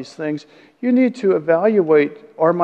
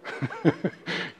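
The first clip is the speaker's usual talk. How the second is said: laughter; 11 dB quieter than usual. Real, not acted. Laughs at own joke.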